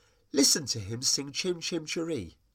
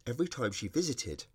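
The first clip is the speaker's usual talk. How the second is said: plain reading voice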